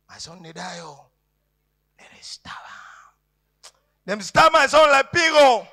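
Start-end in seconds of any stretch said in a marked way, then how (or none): 2.0-3.7 slow, breathy hush; slow grim whisper. Acted. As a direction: whisper it as a dark secret.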